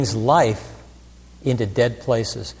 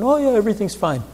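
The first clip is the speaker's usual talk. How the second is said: breezy brush-off; 9 semitones higher, faster than usual. Retold or imitated. Moviegoer brushing off the question.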